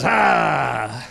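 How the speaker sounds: long falling mock roar; menacing roar. Coach cornering the runaway kid, low mock roar. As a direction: roar as if cornering your prey. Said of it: "and he goes".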